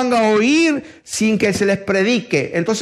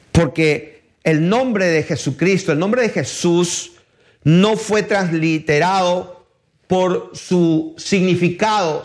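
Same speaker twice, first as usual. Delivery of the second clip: ordinary talk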